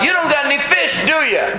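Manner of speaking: high mocking shout from shore; taunting yell. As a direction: yell it like a cheeky stranger. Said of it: "yells the nerve to yell out".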